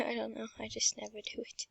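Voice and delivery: hushed embarrassed aside; whispering. Real, not acted. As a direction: whisper it to yourself.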